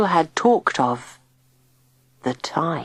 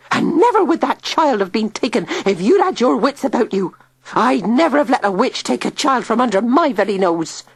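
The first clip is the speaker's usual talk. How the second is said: scolding; 7 semitones higher than usual. A narrator's reading. Tilly scolding Digby.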